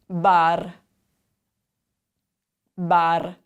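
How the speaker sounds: bar with a tapped Italian R; Italian pronunciation. Shown as a demonstration. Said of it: "In Italian, it sounds a little different".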